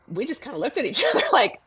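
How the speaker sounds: high, loud, laughing disbelief. Real, not acted. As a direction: laugh it out in amazement. Laughing at surprising success.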